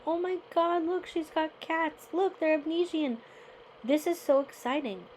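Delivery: high, bright burst of delight over the cats; thrilled squeal of discovery; excited gush. Retold or imitated. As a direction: gush like a kid who just spotted kittens. Spotting the Abyssinian cats.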